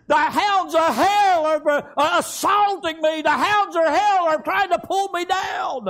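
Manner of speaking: loud, urgent cry for help; loud retold prayer against the hounds of hell; pleading shout. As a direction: call out to God in panic. Crying out to the Lord.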